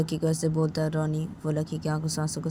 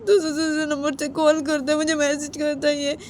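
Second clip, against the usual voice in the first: put-on higher, slightly raised girl's voice; girlfriend voice; 9 semitones higher, 5 dB louder than usual. Girlfriend complaining to her partner, higher girl voice. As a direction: play the upset girlfriend, pitched up.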